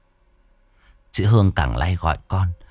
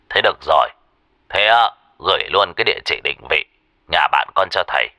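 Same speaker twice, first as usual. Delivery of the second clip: lower-pitched, matter-of-fact master's voice; spiritual master; 3 semitones lower, faster than usual. Acted. Master on the phone asking for address and birth details, spiritual master's voice. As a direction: give practical instructions in a lowered voice. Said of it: "thầy Tâm mới nói".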